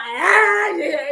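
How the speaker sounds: loud, high imitation of bawling; mock crying wail. Blamer retelling a beach meltdown to the audience, mock crying. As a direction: wail like a whiner, hammed up. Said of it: "I was crying".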